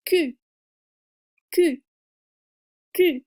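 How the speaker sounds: "Q" as a K plus the French u; K onto a rounded "u". Shown as a demonstration. Teacher modelling the French Q sound. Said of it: "put a K sound in front of that".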